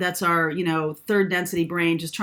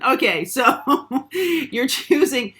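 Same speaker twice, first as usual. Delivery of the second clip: laughing; 7 semitones higher, 4 dB louder, much slower than usual. Real, not acted. Still laughing after silly voice.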